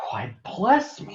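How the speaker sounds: slow, high startled gasp; dismayed discovery; surprised. A narrator's reading. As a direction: engine realising he left his coaches. Thomas sees coaches missing.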